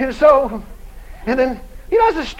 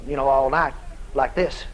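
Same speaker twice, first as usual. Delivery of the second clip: ordinary delivery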